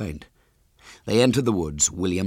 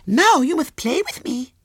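put-on very high little girl voice; squeaky small child, louder; Violet Elizabeth; 6 dB louder than usual. A narrator's reading. Small girl questions her visitor, high and childish.

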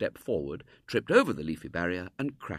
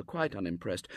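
usual speaking voice

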